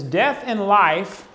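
slow, weighty opening of the verse; preacher quoting Proverbs slowly; slow scripture reading. Real, not acted. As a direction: read it out as solemn scripture. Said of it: "it says".

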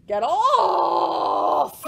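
loud, high yelled "Get off!"; howled. Acted. Dog howling at the cat on his bed, loud yell. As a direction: howl it at the cat. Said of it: "He howled".

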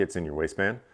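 ordinary delivery

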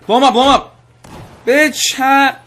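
loud, high gloating shouts; triumphant battle yell. Real, not acted. Gamer blowing someone up with a launcher, triumphant yell. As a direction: yell it at the enemy you are blasting.

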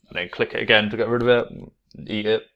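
ordinary talk

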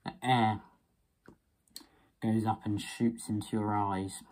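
muffled behind rubber facepiece; speaking inside respirator mask; through a gas mask. Real, not acted. Seen 1: Full-face gas mask worn over mouth throughout labelled speech.

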